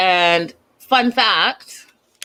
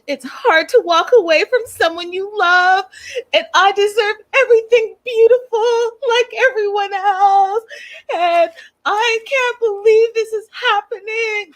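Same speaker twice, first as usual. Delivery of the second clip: very high, wailing mock sobs; Oscar-bait fake weeping; hammed-up crying; 13 semitones higher than usual. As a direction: overact a tearful breakdown in a high squeal. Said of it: "give you guys an Oscar performance".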